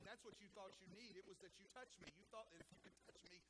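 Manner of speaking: ordinary talk, nothing marked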